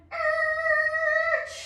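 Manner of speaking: high, loud held squeal; screeching brake noise. Pretend. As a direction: screech to a halt. Imitates car brakes.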